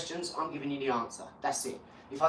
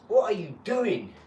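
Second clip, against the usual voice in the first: mock cousin voice; 4 semitones higher, slower than usual. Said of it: "I was like".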